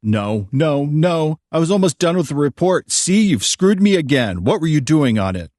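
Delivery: high, loud exasperated outburst; angry demand. A narrator's reading. Dad finds his report lost, angrily demanding answers. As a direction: climb into a loud, high tirade of blame. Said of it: "he demanded".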